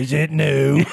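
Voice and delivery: put-on old English pleasantry; pleasant English accent. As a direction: inquire nicely in broad English. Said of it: "but say something pleasant".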